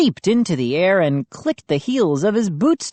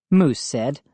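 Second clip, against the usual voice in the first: usual speaking voice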